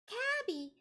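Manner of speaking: tiny squeaky high Kirby voice. Retold or imitated. Streamer doing Kirby Puyo for Discord friend, squeaky high. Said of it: "It's like".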